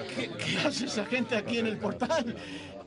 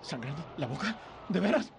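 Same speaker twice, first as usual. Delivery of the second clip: usual speaking voice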